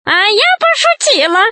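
high, wheedling witch voice; flustered witch. A narrator's reading. Witch excuses herself to the ataman, flustered high voice. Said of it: "засуетилась ведьма".

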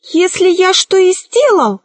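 high, tremulous sorcerer voice; trembling voice. A narrator's reading. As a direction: answer with a nervous high pitch. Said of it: "ответил колдун дрожащим голосом".

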